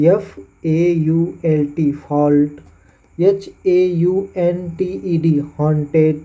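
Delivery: एफ़एयूएलटी fault repeated slowly for students to copy; slow repeat demo. Shown as a demonstration. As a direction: say it again slowly, inviting repetition. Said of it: "या आप उच्चारण करिए". (दोहराकर धीमे fault)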